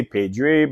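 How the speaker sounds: "Pedro" said as "PAY-dree"; English-style pronunciation. Shown as a demonstration. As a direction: say "Pedro" with an ee ending. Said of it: "English spoken people".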